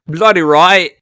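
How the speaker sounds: loud, high bad British accent; a loud half-Aussie attempt at a British accent. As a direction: shout it in a botched British accent. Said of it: "when I try to do British, I sound Australian".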